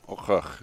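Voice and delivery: "Augurk" with a guttural back-of-throat ch, like about to throw up; "Augurk" scraped out of the throat, nearly retching; gagging. Real, not acted. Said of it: "I sound like I'm about to throw up".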